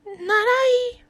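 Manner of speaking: high, loud purred reply; purring cat. Acted. The lazy cat refusing, purred. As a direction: purr it lazily. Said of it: "purred the lazy orange cat".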